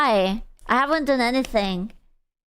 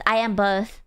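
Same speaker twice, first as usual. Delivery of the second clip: normal talking voice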